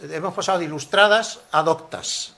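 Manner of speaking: ordinary delivery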